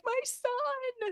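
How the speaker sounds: hammed-up, high-pitched cry of grief; heartbroken mother. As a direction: imitate a grieving mom wailing. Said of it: "it was heartbreaking to see that mom".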